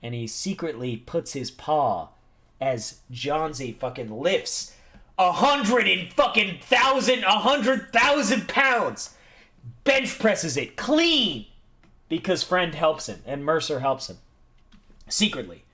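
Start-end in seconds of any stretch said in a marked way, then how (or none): 5.2-11.5 high, slow, emphatic exaggeration; hammed-up climax of a tall tale; triumphant storytelling. A narrator's reading. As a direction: draw out the impossible feat with relish. Climax of the weightlifting feat.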